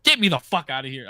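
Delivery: storming off after a lost bet, retold; pissed off. Bettor throwing away a losing ticket, pissed off. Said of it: "I was fucking pissed".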